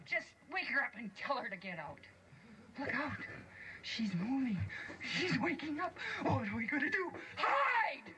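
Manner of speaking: high, rising dwarf voices to a shout; panicking dwarfs. Acted. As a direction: build to a frantic shout to hide. Dwarfs panic as she wakes.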